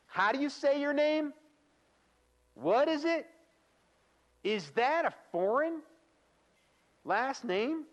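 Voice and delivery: loud, high-pitched mock incredulity; mocking questioner. Trainer acting out someone mocking a foreign name, high loud mockery. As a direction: pitch it way up, poking fun at the name. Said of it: "mocking someone's accent is a common example".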